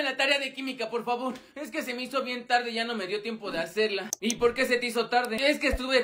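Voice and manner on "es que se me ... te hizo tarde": usual speaking voice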